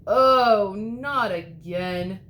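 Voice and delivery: loud oh, then slow groaning not again; dismayed cry. A narrator's reading. The wolf landing in hot water, loud dismayed wail. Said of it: "cried the wolf".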